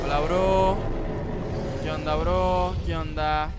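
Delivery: nervous greeting. Real, not acted. Gamer greeting a strange creature, loud nervous bravado. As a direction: bluff friendliness at a scary creature.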